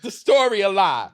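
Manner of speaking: put-on child talking back to grandma, loud and cheeky; mock sassy comeback. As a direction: fire it back at grandma, bold. Repeats comeback.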